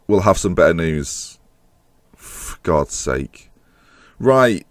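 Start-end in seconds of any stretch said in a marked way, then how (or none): 2.1-3.5 slow exasperated mutter. Real, not acted. Frustration at end.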